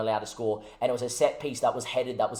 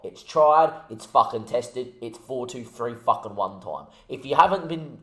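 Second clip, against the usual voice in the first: ordinary talk, nothing marked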